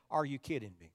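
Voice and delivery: low, quiet incredulity; disbelief. Acted. Preacher reacting to watching the news, in low quiet disbelief. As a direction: drop down soft, as if stunned. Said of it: "I watch the news and say".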